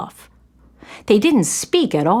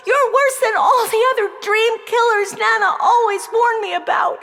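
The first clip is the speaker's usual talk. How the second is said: put-on girl raging at the fish who betrayed her; enraged accusation; 10 semitones higher than usual. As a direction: hurl it at him like a curse. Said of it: "her face turned red with rage and betrayal".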